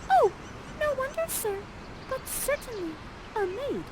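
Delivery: put-on very high, soft girlish voice; Miranda. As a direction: play a demure maiden, high and light. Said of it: "Miranda, you inch a little closer and you say".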